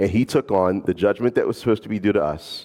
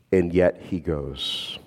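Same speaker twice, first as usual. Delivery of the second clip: slow and softer, weighted; slow emphasis; 4 semitones lower, 3 dB quieter, much slower than usual. Real, not acted. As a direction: let it land gently. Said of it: "repeated slowly for emphasis".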